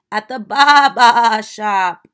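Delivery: loud slow bleating sheep voice; hammed-up sheep punchline. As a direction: stretch Baba into a big sheep bleat.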